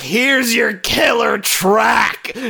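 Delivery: high, stretched-out dramatic announcement; hammed-up movie line. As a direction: draw it out like a big action-movie moment. Acts out character's dramatic movie line.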